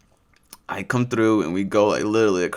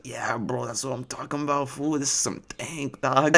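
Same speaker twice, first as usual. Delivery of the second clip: [name]; 3 semitones higher, 5 dB quieter than usual. Retold or imitated. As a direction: imitate a pleased stoner homie's accent. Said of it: "I'm not just doing this accent".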